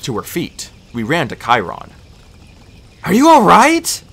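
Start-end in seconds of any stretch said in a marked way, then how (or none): 3.0-4.0 loud, high anxious question; raised, worried voice; urgent concern. A narrator's reading. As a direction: call out to a hurt friend. Anxious check on fallen friend.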